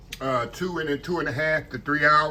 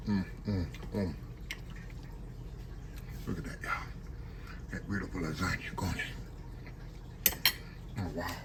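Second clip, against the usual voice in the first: hushed murmur; 8 semitones lower, 13 dB quieter than usual. Real, not acted. Murmuring while eating on camera.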